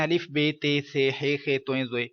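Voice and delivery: الف بے تے سے recited evenly with Urdu "ay" endings; Urdu-style letter names. Retold or imitated. Teacher imitating people who read Arabic letters wrongly, level Urdu-style recitation. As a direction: chant through بے تے سے ہے خے on a level pitch, non-Arabic style. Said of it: "ایسے کر کے پڑھتے ہیں، تو یہ عربی لہجہ نہیں ہے".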